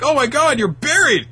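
very high, loud alarmed cry; shocked shout. Acted. Crew finding the buried giant, very high alarmed cry. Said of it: "uh, they're like".

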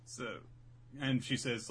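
ordinary talk

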